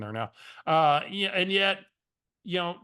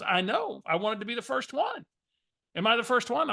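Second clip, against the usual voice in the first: higher, eager imitated caller; Barbara's voice; 7 semitones higher than usual. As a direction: lift the voice up like a keen old acquaintance. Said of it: "use Barbara's voice for effect".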